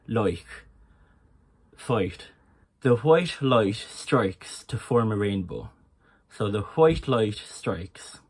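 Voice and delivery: slow Irish accent, I's slightly rounded. Shown as a demonstration. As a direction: lean slowly on each Irish "oi" vowel. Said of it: "sentences that isolate that I sound".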